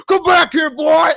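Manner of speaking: high, loud hammed-up villain yell; chainsaw killer shout. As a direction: bellow like a horror-movie maniac. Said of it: "the guy with a chainsaw comes out of the place going".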